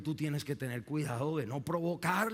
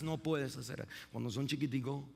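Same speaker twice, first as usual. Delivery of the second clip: usual speaking voice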